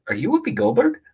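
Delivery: high, mocking rise on Whoopi; sarcastic. Comic recalls sarcastically greeting Whoopi at a bar, mocking high lilt. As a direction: ask it as if it's obviously a dumb question. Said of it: "Sarcastically, like".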